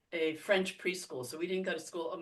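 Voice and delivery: ordinary talk, nothing marked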